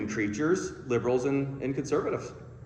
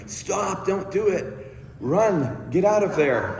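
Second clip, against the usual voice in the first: higher, urgent little voice in the head; inner warning voice; 6 semitones higher, slower than usual. Retold or imitated. Speaker voicing the nagging voice in his head, urgent. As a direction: blurt it like a panicky inner voice. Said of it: "I had this voice going".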